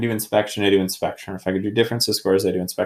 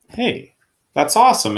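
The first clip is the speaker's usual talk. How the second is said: higher bright burst of delight; 11 semitones higher than usual. Real, not acted. Teacher pleased the factoring worked, excited. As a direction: cheer the result like a small win.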